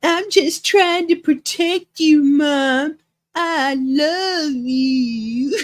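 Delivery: put-on high, slow, whiny baby voice; Brett's whiny baby voice. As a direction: whine it like a big baby. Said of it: "That little whiny titty baby voice he read it with".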